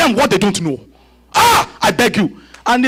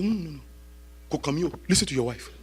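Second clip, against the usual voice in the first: much softer, low and calm; soft voice of God; 8 semitones lower, 15 dB quieter than usual. Retold or imitated. God quietly tells Abraham to listen to his wife. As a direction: say it gently as God.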